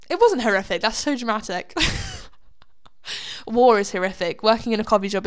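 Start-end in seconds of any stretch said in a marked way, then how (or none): 1.8-2.5 real, high giggle at oneself; high-pitched laugh. Real, not acted. Podcaster amused at her own drama, high laugh.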